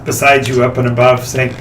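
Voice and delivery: ordinary talk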